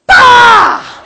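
a burst yell to jolt someone awake; sudden scare shout. Retold or imitated. Brother scaring his sleeping sister with a shouted laughing bah. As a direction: explode a laughing "Bah!" right in her face, very high and loud.

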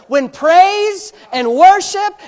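slow, building to a loud high cry; preaching at full force. Acted. Pastor lifting the church, preaching full force. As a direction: swell up to a shout of joy.

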